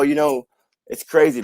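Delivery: ordinary delivery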